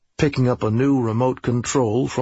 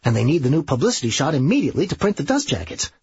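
slightly raised, rapid-fire explanation; hurried Serge; 4 semitones higher, much faster than usual. Acted. Serge tells Coleman about the urgent photo, hurried. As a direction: rattle it off in a rush.

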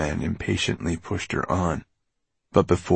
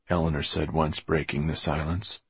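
ordinary delivery

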